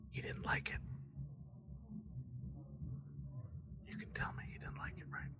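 hushed, voiceless whisper; conspiratorial whisper. Acted. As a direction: whisper it secretly, barely audible. Hooded voice whispers to the player.

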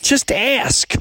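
high, exasperated comeback; mock-exasperated high voice; retold wife's retort. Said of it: "She's like".

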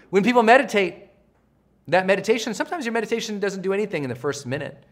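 ordinary talk

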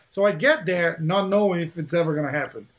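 usual speaking voice

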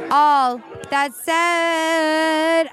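very slow, stretched-out restart; drawn out. Real, not acted. Host steering back to her order, drawn out. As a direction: stretch the words out long and high.

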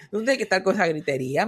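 normal talking voice

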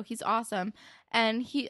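ordinary talk, nothing marked

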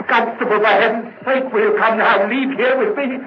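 high, loud, desperate begging, slowing at the end; frantic pleading. Acted. Guilty man frantically begs the constable to leave, high and loud. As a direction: plead to leave as if the floor might give you away. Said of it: "there's no need to get so excited".

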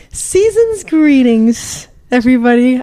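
drawn-out festive announcement; slow, showy season's greeting without cracking; festive greeting retake. Shown as a demonstration. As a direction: stretch out a slow holiday greeting. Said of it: "I'm gonna try it again".

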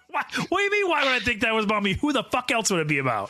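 high, fast indignant protest; mock outrage. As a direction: play the outraged victim.

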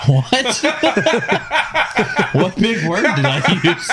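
high giggling disbelief; cracking up while asking; high laughing protest. Real, not acted. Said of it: "You keep saying application".

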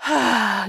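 long heavy sigh through the nose; husband sighing heavily at the neighbors' noise; deep soulful sigh. Retold or imitated. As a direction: let out a deep, weary sigh. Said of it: "this deep, soulful sigh".

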